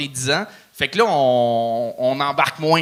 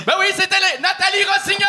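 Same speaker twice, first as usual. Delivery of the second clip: hyped announcement; faster than usual. Real, not acted. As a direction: whip up the crowd as you announce her. (annonce de l'humoriste, criée avec enthousiasme)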